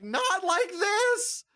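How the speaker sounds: hammed-up movie anguish; dramatic despair. Watching friend misplay, slow high mock wail.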